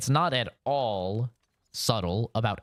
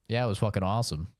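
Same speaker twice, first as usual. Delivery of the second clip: ordinary talk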